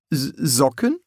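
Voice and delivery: stammered, bewildered question; nervous. A narrator's reading. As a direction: trip on the first sound, nervously. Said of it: "sah nervös zum Vampir".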